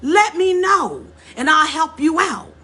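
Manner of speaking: a showy, insincere offer of help. Retold or imitated.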